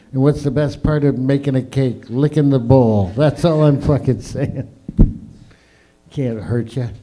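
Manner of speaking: ordinary delivery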